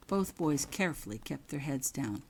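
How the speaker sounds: ordinary delivery, nothing marked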